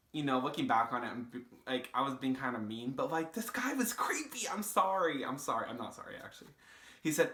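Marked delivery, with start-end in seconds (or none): 4.5-5.2 higher, sing-song "sorry"; fake-sweet "I'm sorry"; teasing insincere apology; mock apology. Mock apology for being mean. 5.7-6.5 breathy whispered "not sorry"; cheeky hushed confession; whispered aside. Acted. Whispered retraction of apology.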